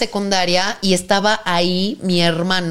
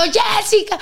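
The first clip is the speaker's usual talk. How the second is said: high mock yell; mother screaming a name; 13 semitones higher than usual. Mother trying to scream for her daughter, high yell. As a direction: cry out her name in panic. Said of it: "me estaba gritando".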